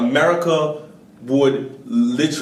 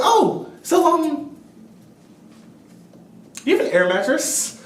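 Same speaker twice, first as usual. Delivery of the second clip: sheepish asking; 13 semitones higher than usual. Retold or imitated. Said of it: "They're like".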